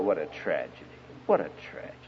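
low, soft lament; quiet dismay. Retold or imitated. Preacher reflecting on a girl's strict parents, quietly dismayed. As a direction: sink into a hushed, regretful thought. His saddened thought after girl's story.

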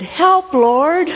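retelling a frantic plea to the Lord in the coffee shop; desperate cry for help. Retold or imitated. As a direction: cry out to God in sudden panic. Desperate quick prayer in crisis.

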